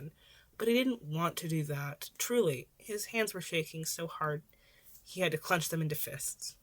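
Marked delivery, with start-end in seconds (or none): none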